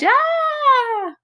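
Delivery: very high startled shriek. Acted. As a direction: shriek in sudden fright. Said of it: "she shrieked".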